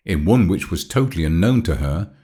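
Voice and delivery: ordinary delivery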